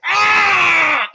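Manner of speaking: high, loud shriek of pain; shark's pained scream. Acted. Shark breaks its teeth on armor, high shriek. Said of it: "He pulls back like".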